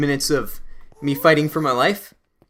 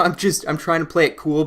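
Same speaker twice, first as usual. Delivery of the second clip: ordinary talk, nothing marked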